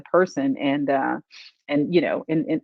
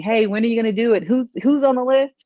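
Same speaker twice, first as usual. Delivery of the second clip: higher, quicker impatient asking; pestering questions; 6 semitones higher, 4 dB louder than usual. Retold or imitated. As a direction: imitate eager people nagging for news. People's impatient emails, retold.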